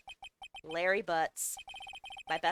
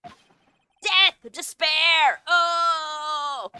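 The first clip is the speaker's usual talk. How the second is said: loud anguished wail, pitched up; wailing despair; 6 semitones higher, 11 dB louder, much slower than usual. Acted. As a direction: howl it like the world ended. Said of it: "he sounds like me on a bad day".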